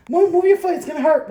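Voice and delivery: high, loud urgent call; loud warning. Real, not acted. Mom warning son about his foot, loud. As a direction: warn him fast.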